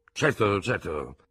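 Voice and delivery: slow, distracted; doctor humouring him, mind on the flute; absent-minded. A narrator's reading. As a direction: say it with your mind elsewhere. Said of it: "disse distrattamente".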